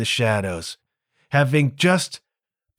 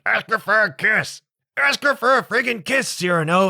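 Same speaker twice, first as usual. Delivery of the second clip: much higher, urgent pleading voice; eager pushing; 8 semitones higher than usual. Retold or imitated. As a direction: push him hard, desperate for the kiss.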